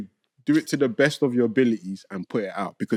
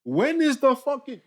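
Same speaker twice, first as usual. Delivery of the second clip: exasperated; 10 semitones higher, 4 dB louder than usual. Real, not acted. Ranting about mastering.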